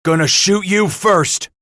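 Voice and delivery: loud, high-pitched angry threat; furious threat. A narrator's reading. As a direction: hurl the threat in a rage. Cooper threatens Bass, trembling in fury.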